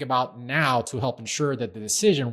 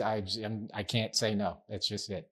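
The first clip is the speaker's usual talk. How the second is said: ordinary delivery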